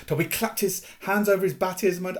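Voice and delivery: ordinary delivery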